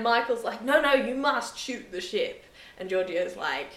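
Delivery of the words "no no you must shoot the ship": insistent order. Retold or imitated. Michael insisting on firing.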